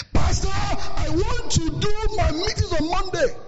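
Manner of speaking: high, slow, whiny member. Retold or imitated. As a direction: plead slowly and high. Voicing an entitled member.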